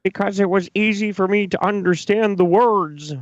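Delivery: high put-on mocking voice; mocking mimicry. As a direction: pitch it up high to ridicule his reasoning. Continues mocking the speaker's reasoning.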